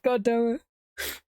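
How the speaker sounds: very slow, drawn-out mock groan of a line; hammed-up deflated cop; disappointed agent. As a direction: let the letdown sag. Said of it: "It's like".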